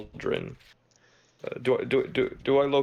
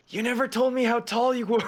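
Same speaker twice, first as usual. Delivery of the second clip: high-pitched playful complaint; mock indignant squeal; 11 semitones higher, 3 dB louder, faster than usual. Player complaining to Aaron about height, high mock indignation. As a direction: pitch it way up in teasing protest.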